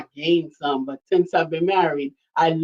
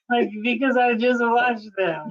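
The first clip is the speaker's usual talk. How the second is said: usual speaking voice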